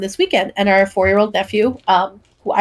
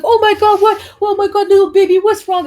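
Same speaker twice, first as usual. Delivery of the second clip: very high, louder fretting; mock panicked niece; 11 semitones higher, 5 dB louder than usual. Niece rushing to the crying baby, high and alarmed. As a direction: gush with alarm over the baby. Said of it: "one of the girls came over and was like".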